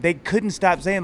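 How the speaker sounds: usual speaking voice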